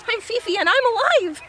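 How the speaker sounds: high, loud, fast squeal; triumphant small dog. A narrator's reading. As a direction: proclaim it proudly as a tiny dog. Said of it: "holds her tiny head up high".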